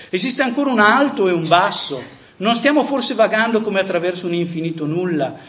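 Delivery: dramatic reading. Acted. Preacher reading Nietzsche's madman parable to his audience, impassioned. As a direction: declaim the madman parable like a prophet, building then sinking to a hush.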